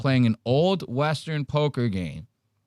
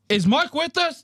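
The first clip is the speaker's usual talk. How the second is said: mock checking in. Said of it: "They go".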